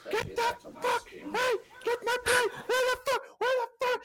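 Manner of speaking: very high, strained mock yelling; comically furious high-pitched cursing; swearing cook. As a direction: imitate a ranting kitchen tyrant. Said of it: "this guy swears".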